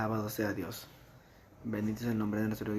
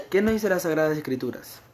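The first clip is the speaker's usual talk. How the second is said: fervent preaching; 4 semitones higher, 8 dB louder than usual. Acted. Preaching at full force.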